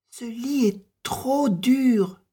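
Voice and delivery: put-on high girlish voice; a little girl complaining, high-pitched; Boucle d'Or. A narrator's reading. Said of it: "dit-elle". (voix de petite fille plaintive, aiguë)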